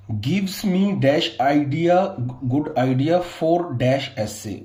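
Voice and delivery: drawn-out exam-sentence reading; very slow dictation. Shown as a demonstration. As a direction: read it out word by word. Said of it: "teacher dictates exercise sentence".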